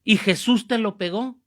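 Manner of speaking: loud, high challenge; acted indignant questioning. Priest confronting the man who blamed Jesus, acted indignation. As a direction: demand an answer with mock outrage.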